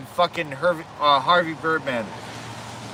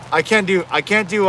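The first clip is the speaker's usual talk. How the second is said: normal talking voice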